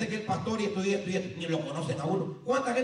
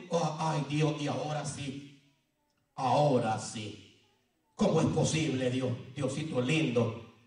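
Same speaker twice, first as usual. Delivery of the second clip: mock lament; 7 semitones lower, slower than usual. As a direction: sigh it out as if crushed. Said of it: "José estaba llorando".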